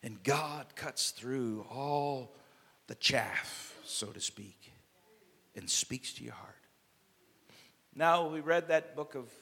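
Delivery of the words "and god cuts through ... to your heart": soft, slow. Acted. Slow soft after the climax.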